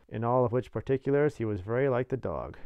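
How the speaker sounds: plain reading voice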